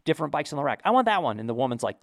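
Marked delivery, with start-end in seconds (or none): 0.8-1.4 eager want. Retold or imitated. Wife picks a bike, imitated.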